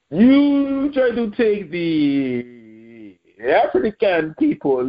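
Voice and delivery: high, drawn-out mock French voice; mock French accent. Vlogger spoofing the French ambassador over African players, mock French accent.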